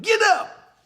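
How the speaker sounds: high, loud shout to the oxen; shouted command. Acted. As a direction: yell it out to get a team moving. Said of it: "cracked his whip and shouted".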